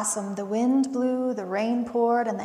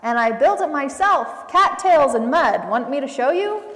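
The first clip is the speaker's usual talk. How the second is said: a higher, louder, proud muskrat; 4 semitones higher, 9 dB louder than usual. A narrator's reading. Muskrat boasting to the possums about his lodge, loud and proud. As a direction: boast like a builder showing off his work.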